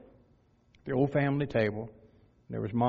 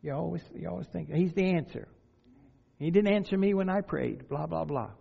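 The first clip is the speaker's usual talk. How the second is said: higher-pitched mimicked doubter; skeptic; 5 semitones higher than usual. Preacher voices a skeptic answering Christians, higher mimicking voice. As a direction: imitate a complaining skeptic, pitched up. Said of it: "to the skeptic, they're saying".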